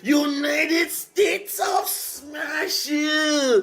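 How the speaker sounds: high, loud, slow shouted half-singing; hype shouting. Real, not acted. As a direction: belt it out in excitement. Hype over the father's punch.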